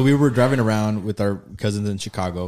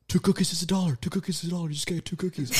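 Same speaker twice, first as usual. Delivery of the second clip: soft, higher-pitched hushed quote; imitating whisper; 7 semitones higher, 6 dB quieter than usual. Friend advising in the back seat, imitated hushed whisper. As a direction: urge it under your breath, pitched up. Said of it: "We're all whispering".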